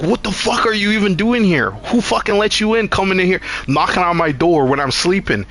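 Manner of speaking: high, outraged questioning. Retold or imitated. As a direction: demand answers, outraged. Confronting intruder at door.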